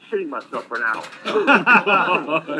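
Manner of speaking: laughing through the words, slow and loud. Real, not acted. Telling the podcast hosts the embarrassing part, laughing. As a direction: crack up while telling it.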